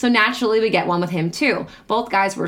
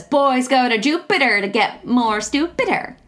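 mocking singsong; 5 semitones higher, slower than usual. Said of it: "it says".

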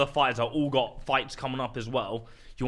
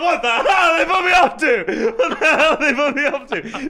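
laughing; 9 dB louder than usual. Retold or imitated. Shocked retelling, laughing hard.

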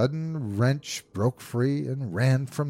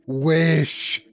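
loud, raised command; strong cry; 6 semitones higher, 5 dB louder than usual. A narrator's reading. Wife insists her husband make the wish, strong cry. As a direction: repeat the order forcefully. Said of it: "cried in a strong voice".

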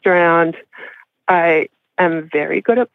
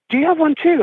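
confused surprise; 10 semitones higher, faster than usual. Retold or imitated. Boyfriend confused about the vape.

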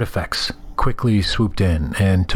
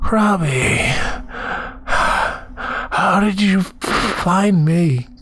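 weak dying voice; 11 semitones higher, much slower than usual. A narrator's reading. Dying man speaks to his dog.

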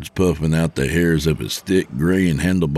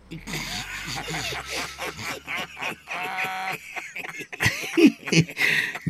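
long high laughing fit, softer than speech; acted laughter; 8 dB quieter than usual. Truckers laughing at a mother joke, amused. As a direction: laugh on and on at the jibe.

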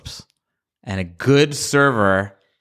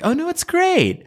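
much higher, falsely gushing praise; fake praise; slower than usual. Forcing fake praise of a friend's book, pitched high. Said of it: "do that fake".

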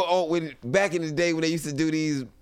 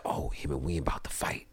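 low, hushed gossip; excited whisper; 10 semitones lower, 5 dB quieter than usual. Retold or imitated. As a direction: whisper it so the guards can't hear. Inmates whispering about fight.

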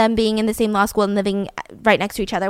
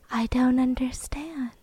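slow, whispered and soft; slow flat whisper; toneless whisper; 9 dB quieter, slower than usual. Retold or imitated. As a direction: breathe it out slowly, barely there. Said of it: "speaking in lowercase".

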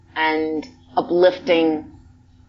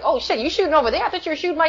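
surprised cousins; 9 semitones higher, much faster than usual. Retold or imitated. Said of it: "they were like".

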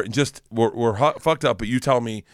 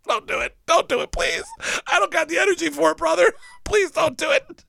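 very high, strained begging; mock pleading. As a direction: beg in a comic high whine. Retold celebrity begging him off.

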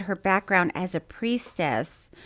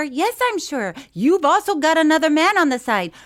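a hint of Miss Cleo, louder at the end; mock psychic insisting; 7 semitones higher, 6 dB louder than usual. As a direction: play the psychic sure of herself. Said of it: "And she's like".

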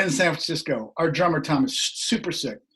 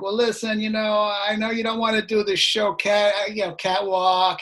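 put-on New York accent; 5 semitones higher than usual. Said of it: "He's got New York accent".

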